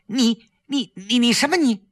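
high-pitched scolding; hastily cutting in. A narrator's reading. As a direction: cut a friend off before they speak. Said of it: "赶紧制止". (急忙高声制止、数落)

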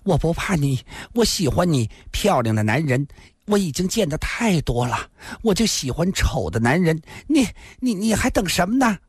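high, breathless seductive woman; panting. Acted. Lin Xian'er clings to Yiku, breathless and high. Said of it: "喘着气道".

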